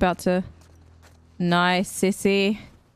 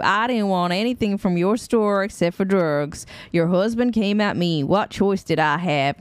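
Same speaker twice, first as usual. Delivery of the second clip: put-on American Southern accent; 4 dB louder than usual. Player reading an apology note aloud, Southern American accent. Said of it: "Anytime I do an American accent, it's always Southern".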